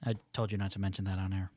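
hushed fast offended owner; quietly scolding, sped up; playing restaurant owner. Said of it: "your Italian restaurant's going out of business".

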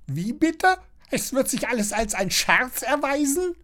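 very high, affronted busybody; high-pitched prattling question; Frau Schnattermann chattering. A narrator's reading. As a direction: squawk back in disbelief. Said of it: "schnattert Frau Schnattermann".

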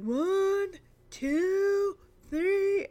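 mock patient grandpa counting slowly. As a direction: stretch each number out for the kid. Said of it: "No, you have to do it slower".

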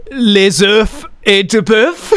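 hesitant exam French, unsure of each word; panicked French. Retold or imitated. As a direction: blurt it out in nervous French. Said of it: "the only words that came out of me".

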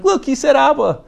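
high, loud excited exclamation; delighted parent. Retold or imitated. Parent marvelling at what the baby said, excited and high. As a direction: crow proudly over a baby's first word. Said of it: "and you're like".

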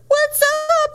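very high, drawn-out greeting; hammed-up high voice for a character in disguise; put-on alter-ego voice. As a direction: put on a squeaky stage-persona voice. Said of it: "she even has, like, a different voice".

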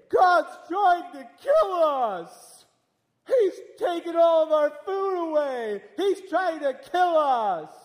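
high, loud, slow panicked cry; panicked shout. Retold or imitated. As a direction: wail it like the sky is falling. People complaining God kills them.

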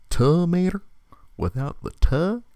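high Southern twang on tomato; Mater voice. Shown as a demonstration. Still in the Mater voice for posterity. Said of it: "I'll do it".

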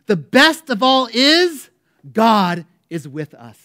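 loud, slow, drawn-out proclamation; John Wesley crying out. Retold or imitated. Dying preacher in his last moments, loud slow cry. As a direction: cry it out with all remaining strength. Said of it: "Wesley cried out".

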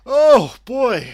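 high, very loud outburst; loud exclamation. Acted. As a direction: belt it out high and suddenly.

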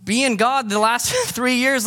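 lightly voiced disciple with a laugh; mock disciple plea. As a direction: plead like a bemused follower. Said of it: "like, like".

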